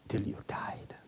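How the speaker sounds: soft whispered ending. Real, not acted. Preacher describing the leper's fate, whispered. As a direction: let it die away in a whisper. Grim end of a sentence.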